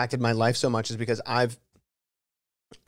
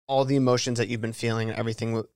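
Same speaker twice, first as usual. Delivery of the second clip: ordinary delivery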